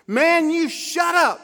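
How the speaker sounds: high, loud slow shout; hollering. Retold or imitated. Teen hollering at counselor before swinging, loud high shout. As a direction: holler it right in his face. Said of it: "he hollered".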